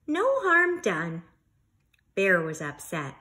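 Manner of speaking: lively, expressive storybook reading; reading to children. Acted. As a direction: read it like story time for a class. Teacher reads picture book aloud.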